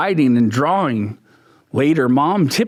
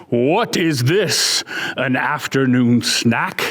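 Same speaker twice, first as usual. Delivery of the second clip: lion voice; 4 semitones lower than usual. Acted.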